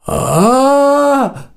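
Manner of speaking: long high wail of a victim; high-pitched wail. A narrator's reading. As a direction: cry out in a drawn, very high howl. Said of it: "завопил Гелька очень натурально".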